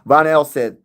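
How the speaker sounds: normal talking voice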